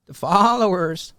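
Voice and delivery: high, loud, slow "followers"; mocking drawn-out voice. Shown as a demonstration. Commentator showing the voice for sheep-like fans, mocking and stretched. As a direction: stretch out "followers" like a put-on taunt. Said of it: "why do you do that with your voice".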